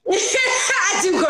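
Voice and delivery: laughing high shout; real laugh while admitting it. Real, not acted. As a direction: burst out laughing at yourself. Laughing at own pity party.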